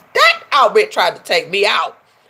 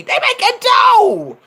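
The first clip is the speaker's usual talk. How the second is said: excited shout; slower than usual. Real, not acted. Vlogger hyping the baby's money to viewers, shouting. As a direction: holler it with delight.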